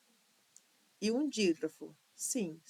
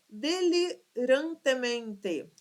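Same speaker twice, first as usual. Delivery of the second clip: delirantemente bem articulado; 4 semitones higher, 3 dB louder, much slower than usual. Shown as a demonstration. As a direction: stretch delirantemente slowly, syllable by syllable. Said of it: "Apresenta seis sílabas". (delirantemente devagar, marcando as sílabas)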